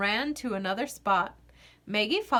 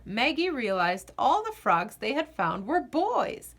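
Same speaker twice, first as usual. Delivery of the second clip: plain reading voice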